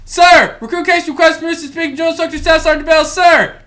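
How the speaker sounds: high, rapid-fire military request; recruit addressing instructor. Retold or imitated. Recruit requesting permission to speak, in a high, fast formal call. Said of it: "so I was like".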